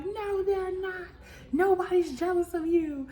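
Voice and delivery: very high, drawn-out mimicked denial; mock protest. Recapper mimicking a castmate denying anyone is jealous, high mock protest. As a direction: stretch it out in a high, exaggerated imitation. Repeats amused imitation of Natalie.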